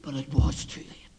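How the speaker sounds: hushed, whispered verdict. Acted. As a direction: drop to a breath after the shouting. Whispered verdict after pleading.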